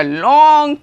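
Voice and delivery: "long" stretched out slowly; drawn-out LONG. Real, not acted. Teacher explaining seasons to pupils, "long" drawn out. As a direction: hold the vowel in "long" to show length.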